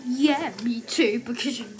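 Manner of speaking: ordinary talk, nothing marked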